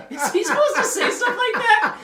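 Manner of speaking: laughing. Retold or imitated. Kid shocked at pastor swearing.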